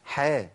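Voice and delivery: «حا» with a thin front a; light ḥ. Shown as a demonstration. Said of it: "لكن عندنا في القرآن حا". («حا» مرققة)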